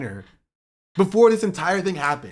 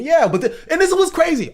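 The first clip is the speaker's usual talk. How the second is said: real heat, louder and higher; getting heated; 11 semitones higher, 6 dB louder than usual. Real, not acted. Streamer flaring up at defenders of Plattner, heated. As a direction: flare up, voice climbing. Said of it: "I'm getting heated".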